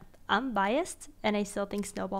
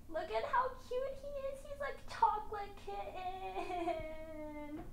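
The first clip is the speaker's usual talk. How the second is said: high, soft squealing coo; cooing over a kitten; 10 semitones higher, 9 dB quieter, slower than usual. Real, not acted. Showing the chocolate kitten photo, soft high coo.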